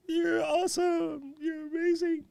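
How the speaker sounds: very high, drawn-out squeaky cheer; mock falsetto praise. Host cheering on a supporter, playful high falsetto. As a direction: sing out praise in a tiny falsetto.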